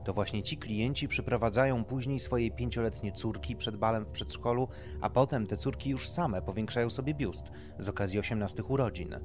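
plain reading voice

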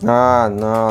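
ordinary talk

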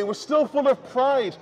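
ordinary talk, nothing marked

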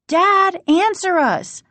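high, loud urgent demand; insistent high cry. A narrator's reading. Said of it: "Margaret demanded".

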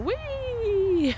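very high squealing whee; excited horse. Retold or imitated. Voicing the horse rolling around, very high gleeful squeal. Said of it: "He's like".

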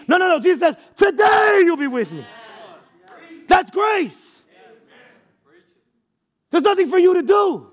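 high, loud preacher's cry; full-force preaching. Real, not acted. Preacher to congregation about grace, full-force preaching.

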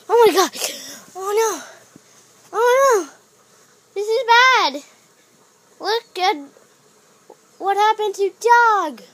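loud panicked cries. Pretend. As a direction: panic over the accident. Alarm at a toy's accident.

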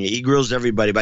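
ordinary talk

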